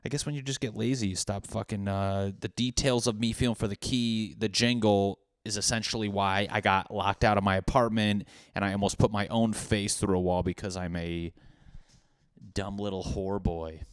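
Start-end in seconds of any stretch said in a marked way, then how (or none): none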